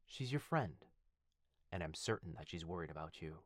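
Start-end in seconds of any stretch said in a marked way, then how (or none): none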